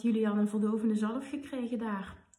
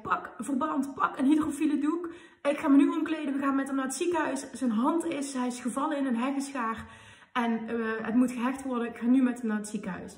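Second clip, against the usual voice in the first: retold urgent orders, higher and louder; 4 semitones higher, 5 dB louder than usual. Partner running in with the hurt child, urgent. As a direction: rattle off the emergency as if rushing in.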